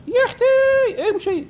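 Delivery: very high, slow put-on woman's voice; exasperated mom scolding. Shooing granny in game.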